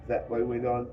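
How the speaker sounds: usual speaking voice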